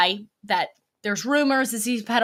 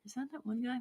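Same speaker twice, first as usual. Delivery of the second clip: a breathless whispered aside to herself; 15 dB quieter, faster than usual. Real, not acted. Reading a chat name, whispering to herself. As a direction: whisper it, just for yourself.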